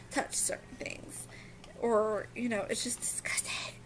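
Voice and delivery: disgusted whisper. Real, not acted.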